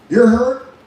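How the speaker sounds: emphatic call-out. Real, not acted. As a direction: throw it at the crowd. Speaker rallying the audience.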